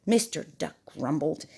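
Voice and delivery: ordinary delivery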